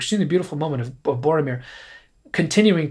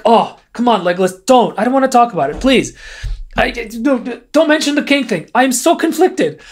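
mock annoyed Aragorn; 6 semitones higher than usual. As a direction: brush it off, irritated and squirming. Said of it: "He's super annoyed".

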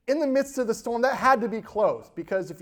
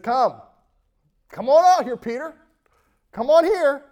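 higher, louder call across water; 8 semitones higher, 8 dB louder than usual. Acted. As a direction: call him out onto the water. Jesus calling Peter.

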